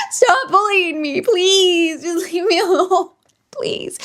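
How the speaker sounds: high, slow fake sob; hammed-up weepy begging; mock crying plea. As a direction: whine it like a tearful victim. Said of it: "And I'm like".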